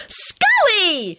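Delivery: very high, thrilled squeal; high-pitched girlish delight; delighted shriek. A narrator's reading. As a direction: shriek the greeting with joy. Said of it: "she shrieked delightedly".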